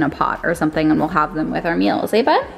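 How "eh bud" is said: squeaky dog talk. Real, not acted. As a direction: coo excitedly at the puppy. Talking to puppy.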